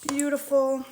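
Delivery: high, loud and delighted. Real, not acted. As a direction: gush over the dough. Admiring the mixed dough.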